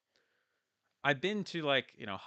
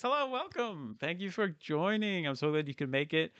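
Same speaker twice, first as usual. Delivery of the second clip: excited greeting; 6 semitones higher than usual. Real, not acted. Greeting a viewer on livestream.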